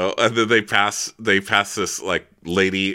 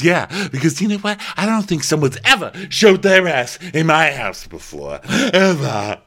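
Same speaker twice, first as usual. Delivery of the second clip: higher and louder, huffy outrage; indignant Dorinda; 9 semitones higher, 5 dB louder than usual. Retold or imitated. Dorinda defending her house rules, in high huffy outrage. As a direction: puff up, scandalised. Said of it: "She goes".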